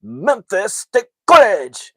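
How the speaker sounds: high, loud, slow mock-Korean announcement; Korean GPS voice. GPS announces their destination, loud Korean-accented voice. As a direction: blare it out in an exaggerated Korean accent. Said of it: "The way it says it, it's so funny".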